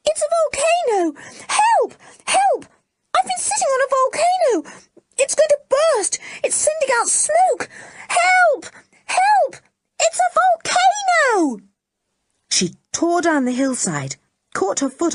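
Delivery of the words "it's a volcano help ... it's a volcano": very high, loud panicked screams; girl fleeing downhill, screaming for help. A narrator's reading. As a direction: shriek for help as you run. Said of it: "screaming loudly".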